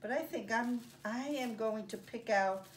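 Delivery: usual speaking voice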